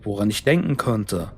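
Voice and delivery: usual speaking voice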